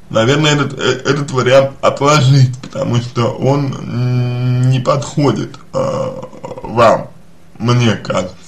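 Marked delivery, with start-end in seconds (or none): none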